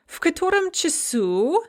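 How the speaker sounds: в котором часу with the last u of часу clearly stressed; stressed u ending. Shown as a demonstration. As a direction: lean on the final u in часу. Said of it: "note the stressed u ending".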